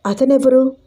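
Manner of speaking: puzzled. Acted. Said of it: "అయోమయంగా అడిగింది రూప".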